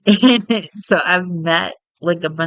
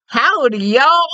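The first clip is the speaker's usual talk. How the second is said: put-on heavy Texas accent; 8 semitones higher, 5 dB louder than usual. Demonstrating Texan French to Ray as a prank, heavy Texas accent. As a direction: exaggerate a Texas twang. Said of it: "more with a really heavy Texas accent".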